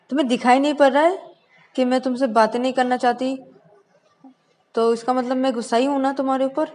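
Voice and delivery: loud, high, angry snapping; angry retort. Acted. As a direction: lash out at her friend. Shreya angry at Shruti on call. (गुस्से में ऊँची आवाज़)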